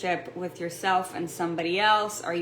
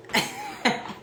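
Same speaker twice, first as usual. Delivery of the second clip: startled gasp; 2 semitones higher than usual. Retold or imitated. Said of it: "and you're like".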